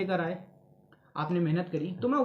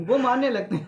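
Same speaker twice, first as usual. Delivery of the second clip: higher and louder, through laughter; laughing; 5 semitones higher, 5 dB louder than usual. Real, not acted. Interviewer joking with guest about students hitting someone, laughing. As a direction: joke loudly while laughing.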